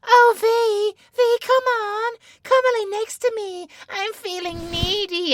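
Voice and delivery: squeaky parody of someone begging for cuddles; mocking needy voice. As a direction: ham up a clingy, needy lover. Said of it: "But I remember how you sounded".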